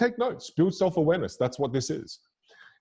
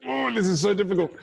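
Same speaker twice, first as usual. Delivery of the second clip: mock frustration; 7 semitones higher than usual. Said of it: "You know, you'll go".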